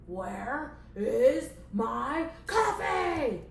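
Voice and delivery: very slow, word-by-word angry demand; hammed-up boss tantrum. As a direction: draw out each word, building to a yell. Boss demands his coffee.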